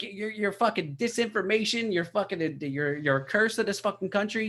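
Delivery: Bill Burr impression. Podcaster imitating Bill Burr ranting at CNN, high and fired up. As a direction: rant like a worked-up stand-up comic. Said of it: "that wasn't the best Bill Burr".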